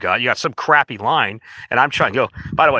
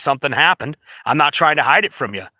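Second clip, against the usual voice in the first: ordinary talk, nothing marked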